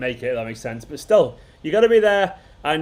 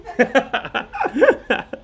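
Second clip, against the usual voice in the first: high laugh; 9 semitones higher than usual. Real, not acted. Laughs after reciting haiku.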